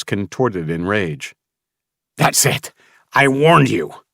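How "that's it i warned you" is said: high furious roar, WARNED punched. Acted. Mason losing his temper, furious roar. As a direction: explode at them. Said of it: "he roared".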